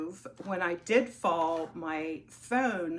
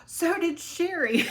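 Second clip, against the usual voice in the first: high, loud put-on mom voice; confused mother; 12 semitones higher, 7 dB louder than usual. Retelling the elderly mother's mix-up, in a high comic mom voice. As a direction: imitate your flustered old mom, much higher.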